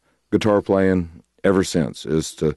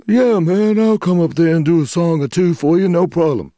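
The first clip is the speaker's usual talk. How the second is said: imitated easygoing agreement, pitched up; 8 semitones higher than usual. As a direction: play an older bluesman happily saying yes. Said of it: "And [name], you know, it just".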